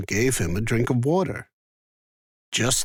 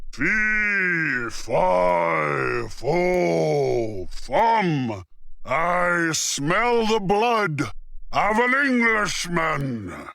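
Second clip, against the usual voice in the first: slow, drawn-out menacing giant; roaring giant; 5 semitones higher, much slower than usual. A narrator's reading. Giant sniffing for Jack, slow menacing roar. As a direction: boom it out like a hungry giant. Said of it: "The giant sniffed the air and roared".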